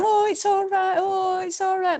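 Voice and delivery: very high, soothing coo; cooing sympathy. Retold or imitated. Volunteers comforting a crying runner, high soothing coo. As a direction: coo like you're comforting a child. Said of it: "instead of them going".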